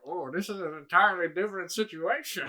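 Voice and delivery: put-on inspector voice; imitated inspector. Inspector reacting to the talking monster, imitated voice. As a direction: do the inspector's changed-tune remark in character. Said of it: "and the inspector's like".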